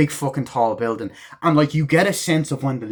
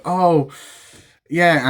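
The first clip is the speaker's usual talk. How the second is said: high, loud breezy "oh yeah"; lightly voiced young woman; girlfriend voice; 6 semitones higher, 6 dB louder than usual. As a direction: play the confident girlfriend, pitched up.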